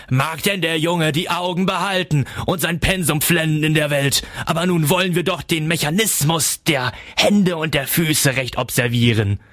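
high-pitched gloating cry; shrill cry. A narrator's reading. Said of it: "gellend auf und rief".

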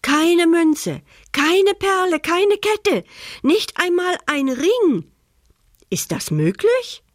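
rising shocked list; shocked outburst. Acted. Thief staring into the empty chest, rising shocked cry.

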